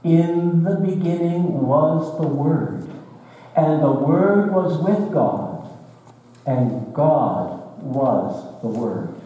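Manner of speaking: slow and solemn, with weight on "the", "Word" and "God"; a preacher reading out the verse in careful translation; measured scripture reading. Shown as a demonstration. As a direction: lay each line down slowly and deliberately. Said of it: "That is the way that the Greek".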